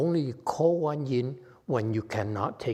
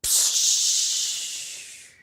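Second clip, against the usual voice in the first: a quick hiss like air leaving a balloon; hissing balloon sound; deflating hiss. Shown as a demonstration. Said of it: "it dies just like this".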